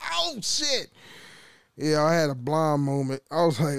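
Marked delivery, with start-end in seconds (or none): 0.1-0.9 high, softened retold yelp; mock yelp at a sudden burn; pained yelp. Worker retelling burning his finger on a drill bit, startled.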